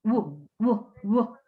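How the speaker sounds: short, repeated "wu" from the throat; voiced W sound. Shown as a demonstration. Teacher isolating the sound that starts well, voiced W sound. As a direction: sound out "wu" in separate bursts.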